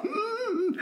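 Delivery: high, held "mm" of shocked amusement; high mock hum through laughter; mock knowing hum. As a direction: hum it like holding back a laugh. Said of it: "so they're like".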